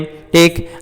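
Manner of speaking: ordinary talk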